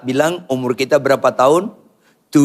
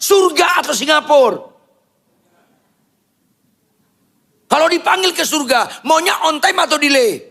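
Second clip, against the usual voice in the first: preaching at full force; 12 semitones higher than usual. Acted. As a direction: hammer it at the crowd. Preacher rails at congregation.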